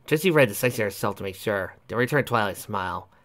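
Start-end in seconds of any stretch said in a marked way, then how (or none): none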